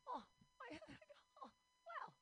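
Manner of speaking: high, giddy squeak with a laugh; hammed-up high gush; flattered squeal. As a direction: squeal in mock delight.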